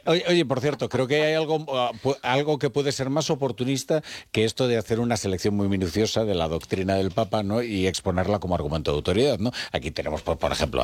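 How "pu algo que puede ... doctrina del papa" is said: ordinary talk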